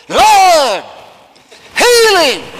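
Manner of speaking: high, loud hammed-up faith healer cry; mock showy healer. Preacher mocking showy healers, hammed-up shout. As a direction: ham it up like a stage healer. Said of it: "How many times have I said".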